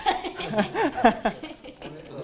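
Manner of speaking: laughing. Real, not acted. Laughs at pineapple result.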